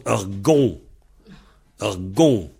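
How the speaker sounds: "gon" as one syllable with nasal ON; variante de l'Ouest. Shown as a demonstration. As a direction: squeeze gouan down to "gon". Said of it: "Dans l'Ouest, là-bas, ils prononcent". (« gon » en une syllabe, comme dans l'Ouest)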